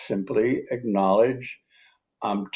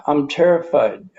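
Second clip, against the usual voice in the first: louder, higher cry of fear; voiced fear; 5 semitones higher, 6 dB louder than usual. Shown as a demonstration. Man left by partner, admitting terror louder. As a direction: let the terror out, raised and loud. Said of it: "Somebody walks out the door".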